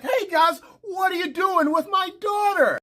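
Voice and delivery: high-pitched acted challenge; alarmed confrontation. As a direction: call out sharply in mock fatherly alarm. Father confronts men throwing child.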